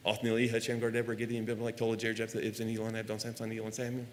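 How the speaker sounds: quick, soft rattled-off list of names; rapid-fire recitation. Shown as a demonstration. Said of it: "Faster than me?".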